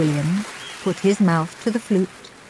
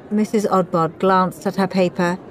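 ordinary delivery

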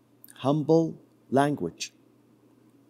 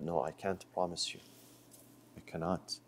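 quiet refusal; 9 semitones lower, 17 dB quieter than usual. Acted. Moses refusing the young man.

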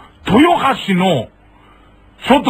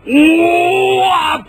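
long drawn-out high cry of joy; a slow, high, overjoyed おーわー; delighted wow; 9 semitones higher, 3 dB louder, much slower than usual. Retold or imitated. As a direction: stretch the wow out in relief. Surprise at finding a bike shop.

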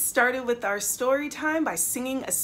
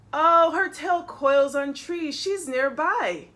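louder, higher storybook eagerness; sudden realisation; 4 semitones higher, 5 dB louder than usual. A narrator's reading. Butterfly thinks Mom is nearby, eager realisation. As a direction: burst out as if the clue clicks.

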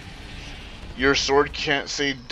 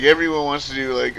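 ordinary talk, nothing marked

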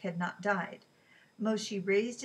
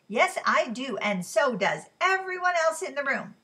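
loud, high, biting comeback; loud angry retort; 6 semitones higher, 10 dB louder than usual. Acted. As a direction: snap back so the whole room hears. Quarrel in a restaurant.

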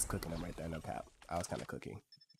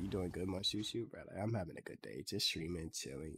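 normal talking voice

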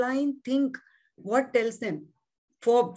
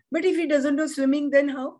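questioning student; 6 semitones higher, 4 dB louder than usual. Retold or imitated. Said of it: "My student got stuck on this".

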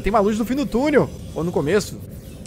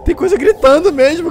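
scared; 8 semitones higher, slower than usual. Real, not acted. Hears creatures screaming nearby.

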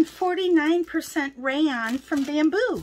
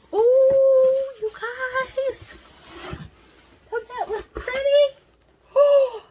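high, loud delighted gasps; overjoyed squeal; 8 semitones higher, 4 dB louder, much slower than usual. Real, not acted. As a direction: squeal over the yarn like a treasure. Unboxing yarn, overjoyed.

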